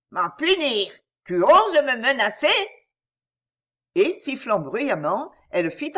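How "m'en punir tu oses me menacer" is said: high, loud indignant outburst; furious disbelief at the threat; outraged. A narrator's reading. Wicked fairy threatened, outraged.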